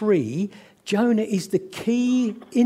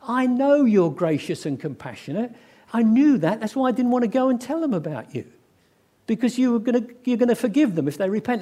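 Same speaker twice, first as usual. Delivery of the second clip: grumpy, pouting complaint, pitched up; Jonah; 5 semitones higher than usual. Acted. Frustrated prophet complaining to God, sulky and accusing. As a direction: protest like a sulky prophet, rushing the grievances. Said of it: "becomes a grumpy, miserable, frustrated, pouting prophet".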